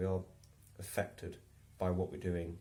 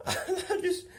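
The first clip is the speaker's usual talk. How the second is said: high, loud laugh through words; cracking up mid-sentence; 6 dB louder, slower than usual. Real, not acted. Remembering Steve's threat, laughing aloud.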